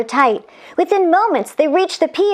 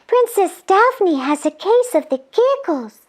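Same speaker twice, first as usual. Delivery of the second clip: put-on lightly raised girlish voice; angel voice; 7 semitones higher than usual. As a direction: explain it with concern. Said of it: "she continued".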